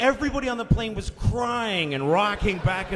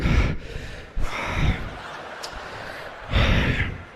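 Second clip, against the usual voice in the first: out-of-breath panting. Acted. Pilot back on after landing, heavy winded breathing. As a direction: heave for breath like a spent pilot. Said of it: "he's totally out of breath".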